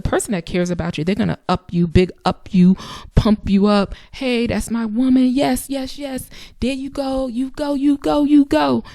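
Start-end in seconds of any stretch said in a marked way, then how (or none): 4.1-8.8 cheering partner. Pretend. Voicing a supportive partner.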